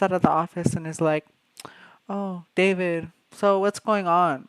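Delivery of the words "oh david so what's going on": not nicely. Retold or imitated. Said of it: "Like, not nicely".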